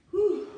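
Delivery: a high, loud whew after the burst; high exhale whoop. Real, not acted. Finishing a hard bike interval for viewers, loud high whew. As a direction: blow out a loud breath of relief.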